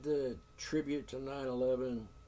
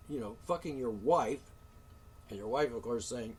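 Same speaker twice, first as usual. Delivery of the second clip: ordinary talk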